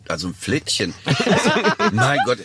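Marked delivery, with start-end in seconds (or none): 1.0-1.9 high laughing burst; a sudden high cackle of laughter. Real, not acted. Laughing at his own Flittchen joke, high burst.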